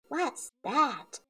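high, surprised piggy voice; Peppa's high little cry. A narrator's reading. Little pig spots something on her plate, high surprised cry. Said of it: "Peppa cries".

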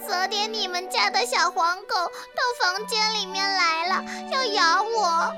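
high, sniffly tearful creature voice; sniffling. Acted. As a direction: sniffle through the story, after crying. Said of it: "小壁虎吸着鼻子说". (吸着鼻子说)

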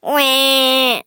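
storybook babies' crying noise; mock baby wail. A narrator's reading. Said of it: "they all cried".

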